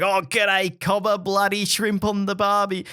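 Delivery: mock a broad Australian greeting; exaggerated Aussie slang accent; thick Australian accent. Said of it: "have your thick Australian accent".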